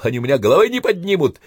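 high, loud, rapid battle shout. A narrator's reading. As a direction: yell it fiercely and fast. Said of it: "кричал Иванцов".

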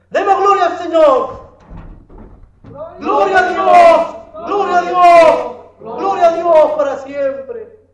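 high, loud fervent praise; full-force preaching. Real, not acted. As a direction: rouse the congregation to give glory. Predica a plena fuerza. (alabanza ferviente, aguda y a toda voz)

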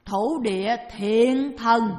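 "thổ địa thiện thần" drawn out slowly and loudly; slow emphatic recitation. Shown as a demonstration. Teacher showing how to address the earth god, slow emphatic "thổ địa thiện thần". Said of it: "Cúng mà đọc là".

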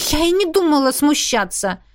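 high-pitched, defiant retort; defiant hiss. A narrator's reading. Heroine denies embarrassment to the teasing dragon, defiant hiss. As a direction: throw it back as a challenge. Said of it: "прошипела, с вызовом посмотрев".

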